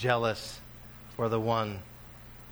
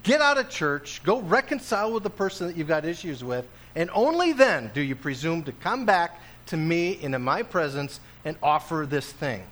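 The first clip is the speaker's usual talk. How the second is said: higher, louder stern orders; commanding Jesus; 4 semitones higher, 3 dB louder than usual. Acted. Preacher voices Jesus sending people to reconcile, stern. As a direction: order them out of church. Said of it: "He says".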